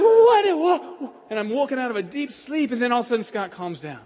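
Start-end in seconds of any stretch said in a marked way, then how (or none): 0.0-1.1 high, loud jolted what; startled awake. Retold or imitated. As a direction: wake with a start.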